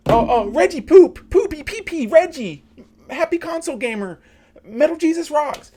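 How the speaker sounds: high, loud, frantic fanboy screeching names; mocking fanboy. Mocking a streamer's chat antics, high frantic screeching. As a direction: shriek the names like an overexcited fan.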